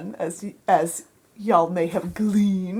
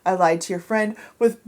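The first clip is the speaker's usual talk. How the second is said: normal talking voice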